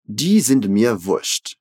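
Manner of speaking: slow, clear Die sind mir wurscht; teacher modelling slowly; slow model line. Shown as a demonstration. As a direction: spell it out for repeating. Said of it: "repete comigo exatamente como ela falou".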